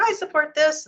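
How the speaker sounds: high, eager little voice; eager colleague. Retold or imitated. Voicing another colleague's email reply.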